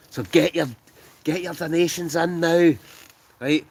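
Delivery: high, loud appeal to the crowd; rallying call. Real, not acted. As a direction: call out like drumming up donations. Calling viewers to donate.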